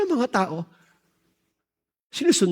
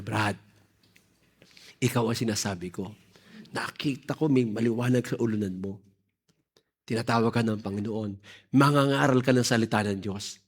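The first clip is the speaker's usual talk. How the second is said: much lower and softer, tender coaxing; gentle old woman; 7 semitones lower, 6 dB quieter than usual. Retold or imitated. Old woman tenderly urging the young man, gentle and hushed. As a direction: speak softly like a kind lady calling him. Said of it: "sobrang amo niya".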